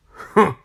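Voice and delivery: short cross "huh"; grunt. A narrator's reading. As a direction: grunt dismissively. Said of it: "grunted Mister Grumpy".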